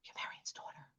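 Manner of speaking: breathless stunned whisper. Retold or imitated. Aunt meeting her sister's lost daughter, whispering. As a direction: barely voice it, stunned. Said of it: "Like that".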